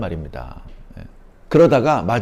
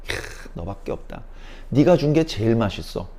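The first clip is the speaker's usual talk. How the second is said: soft delighted thanks; 2 semitones lower, 6 dB quieter than usual. Acted. God gladly receives offering.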